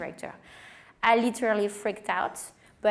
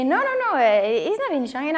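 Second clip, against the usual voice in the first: no, no, no jumping much higher, then settling; amused correction; 5 semitones higher, 5 dB louder than usual. Retold or imitated. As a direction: quickly set her straight, amused. Gentleman corrects her, amused.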